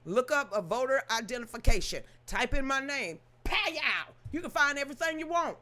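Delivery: normal talking voice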